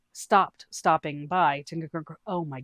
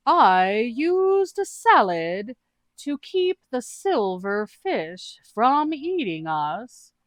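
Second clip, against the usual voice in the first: high, slow, drawn-out goofy voice; a dim, cheerful adventurer explaining cats; [name]'s character voice; 6 semitones higher, 7 dB louder, much slower than usual. Acted. [name] tells the group about cat people, high and slow.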